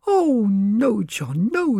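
high, crying-out wife's voice, falling at the end; a wife begging her husband not to sell; pleading wife. Acted. Wife begs husband not to sell the farm, high falling plea. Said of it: "cried Missus Skint".

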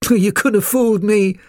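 high, loud poodle puppy voice; scornful poodle. A narrator's reading. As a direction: scoff in a high-pitched character voice. Poodle puppy scoffs at newcomer.

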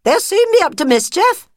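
high, loud bright friend voice; a shrill-pitched busybody mouse cooing; fussy friend mouse. Acted. Friend cooing over the new cubs, high and loud.

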